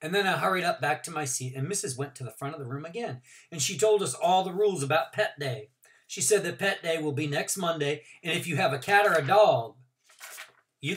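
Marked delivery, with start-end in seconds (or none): none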